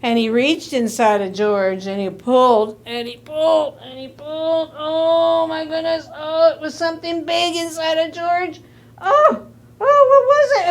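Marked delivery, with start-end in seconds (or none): 2.9-8.6 higher, strained storytelling; straining to pull. A narrator's reading. Narrator acts out a hard pull. 9.0-10.7 high, loud gasping surprise; shocked, high-pitched wonder; astonished. A narrator's reading. Pig pops out.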